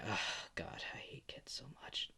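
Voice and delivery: whispered, breathy groan of annoyance; hushed exasperation; exasperated. Acted. Levi exasperated by the crying kid.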